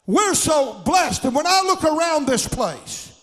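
loud, high, stretched-out shouting praise; preaching at full force. Acted. Preacher shouting to the congregation about God's blessings, full force. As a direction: lift the whole church with a ringing shout.

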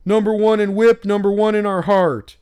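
loud, high mocking imitation of a gushing fan; mock fan yell. Mimicking the Orioles nerd yelling at Tyler Wells, high and loud. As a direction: ham up the fawning fan shouting his praise. Mocking fan's yelled cheer.

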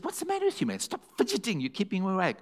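high-pitched mock wife, exasperated; annoyed wife. Wife scolding her restless husband, high mock exasperation. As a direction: play the irritated wife woken at night. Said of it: "she says".

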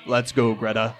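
low, soft-edged creature voice, falling at the end; Basuk. Acted. Companion calls Greta to leave, low character voice. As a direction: speak low as the companion calling someone along. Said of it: "I think he goes".

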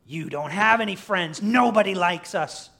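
loud, high taunt; jeering creature. Acted. Preacher as Gollum mocking Smeagol's friendship, jeering. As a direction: hammer it at your weaker self.

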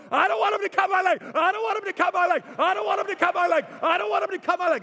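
put-on very high, loud, fast panicked child's wail; whining child. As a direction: wail it like a scared six-year-old. Daughter whining whole drive.

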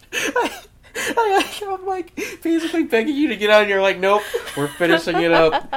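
high, laughing protest. Real, not acted. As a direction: squeal it out through laughter. Laughing, begging to stop.

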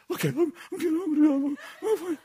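high, quick nervous reassurance; nervous Gary. Retold or imitated. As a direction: squeak out that you're fine, jittery.

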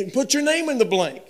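loud, high emphatic preaching; preaching at full force. Acted. Preacher pressing the verse on the congregation, loud and high. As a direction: drive the point home to the congregation.

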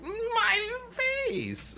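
high shriek of pain; shrieking vampire lady. Retold or imitated. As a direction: cry out as a creature hit by sunlight. Vampire lady burned by light.

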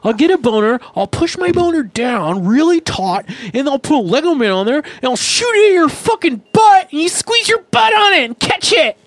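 escalating high-pitched tirade; mock outrage. Sixth-grader threatening a classmate, rising mock outrage. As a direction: climb into hammed-up indignant squeals. Acted outburst at schoolmate.

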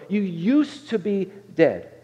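very slow, heavy scripture reading; slow solemn emphasis. Acted. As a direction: let each word land slowly. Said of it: "it says".

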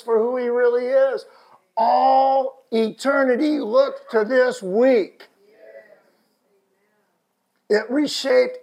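high, loud preacher's appeal; fervent exhortation. Real, not acted. Preaches exhortation at full force.

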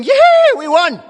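loud, high mock celebration yell; victorious shout. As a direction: whoop like a team that has just won. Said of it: "shouting victorious".

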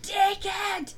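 high, loud shout of one word; a furious yell at a rude stranger; retold yell. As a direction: blurt it at the top of your lungs. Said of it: "top of my lungs, went".

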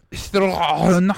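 THRONE drawn out with a throaty French r; exaggerated French THRONE; corrective pronunciation. Shown as a demonstration. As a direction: stretch THRONE, rolling the r in the throat. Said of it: "no, throne".

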